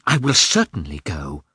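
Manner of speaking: mildly angry insistence; a touch of defiant temper; hasty anger. A narrator's reading. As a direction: insist crossly, stressing the words. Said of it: "said hastily and angrily".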